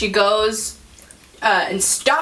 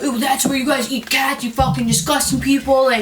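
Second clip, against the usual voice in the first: disgusted customer; 4 semitones higher than usual. Retold or imitated. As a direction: imitate a man hurling a disgusted accusation. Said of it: "and he was like".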